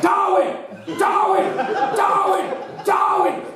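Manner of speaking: shouting a city name. Retold or imitated. Mock rock star shouting the town name to the crowd. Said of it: "I just go everywhere, like".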